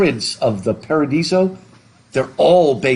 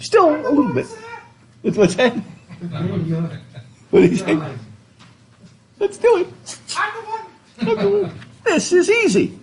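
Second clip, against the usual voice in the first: silly high voice. Pretend. Playful put-on voices.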